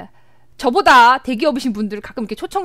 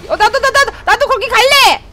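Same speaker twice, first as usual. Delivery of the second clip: high, louder eager cry to join in; excited; 7 semitones higher, 4 dB louder than usual. Real, not acted. Streamer begging to join the teamfight, excited high and loud.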